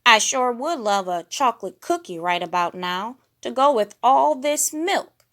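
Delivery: eager bear voice; Fozzie voice. A narrator's reading. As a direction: wish it out loud. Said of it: "he said".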